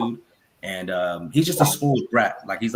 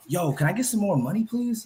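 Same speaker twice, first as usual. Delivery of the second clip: high pleading mock ask rising on please; begging player; 8 semitones higher than usual. Host imitating a player begging the board, high plea. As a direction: ham up a player asking for cash. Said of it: "talking about".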